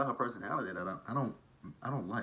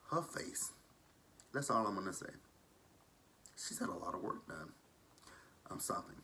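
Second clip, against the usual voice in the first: much softer, dropping to a whisper by the end; gossipy hush; 2 semitones lower, 8 dB quieter than usual. Real, not acted. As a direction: lean in and hush the catty remark.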